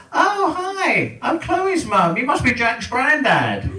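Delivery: high, sing-song mock mum voice; chirpy mum. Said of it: "and then there's".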